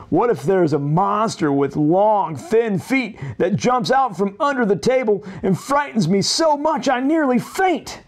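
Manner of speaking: put-on a nervous boy imagining a monster at the letterbox; fretful Jack voice. Lightly voiced anxious Jumpy Jack listing his fears.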